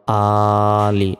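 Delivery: আলি with আ held one second; slow recited আলি; lengthened a. Shown as a demonstration. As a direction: draw out আলি slowly. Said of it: "আতে এক second টান হবে".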